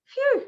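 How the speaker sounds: high-pitched sigh of relief; relieved phew. Real, not acted. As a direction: let out a loud, high phew. Relief at finishing a point.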